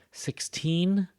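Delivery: put-on "Sixteen" with "teen" high and strong; stress on teen. Shown as a demonstration. Teacher contrasting numbers, lifting "teen" in "Sixteen". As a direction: push the pitch up on "teen" in "Sixteen". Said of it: "notice the stress is here".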